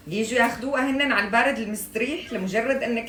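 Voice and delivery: loud, high teasing grievance; mock indignant complaint. Real, not acted. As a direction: complain loudly, half joking.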